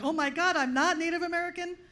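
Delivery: ordinary talk, nothing marked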